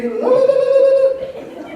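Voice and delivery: very high, loud la-la babble; mock tongues. Mocking Sister Lola speaking in tongues at church. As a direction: lampoon someone speaking in tongues.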